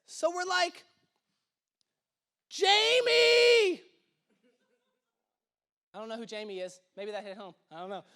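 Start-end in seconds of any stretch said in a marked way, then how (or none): 2.5-3.8 high, loud call across a distance. Pretend. Preacher calling after a person who has gone. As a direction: shout the name after someone who left.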